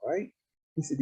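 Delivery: usual speaking voice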